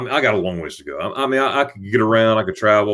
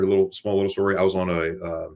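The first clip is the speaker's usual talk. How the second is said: ordinary delivery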